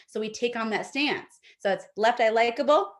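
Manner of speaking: ordinary talk, nothing marked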